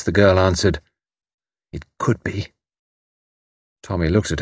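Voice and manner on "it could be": hushed and intense; low, intense voice. Acted. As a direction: answer softly, with held-in intensity. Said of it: "In a low, intense voice".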